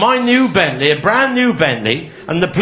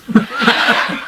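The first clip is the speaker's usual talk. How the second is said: laughing; 3 semitones higher than usual. Real, not acted. Teller laughing at own joke.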